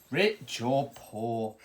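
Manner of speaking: plain reading voice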